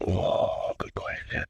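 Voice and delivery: soft, half-whispered thinking aloud; quiet musing. Real, not acted. Podcast guest pondering which story, half-whispered. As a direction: think it over under your breath.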